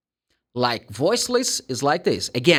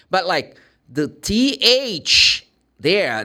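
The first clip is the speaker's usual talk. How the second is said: normal talking voice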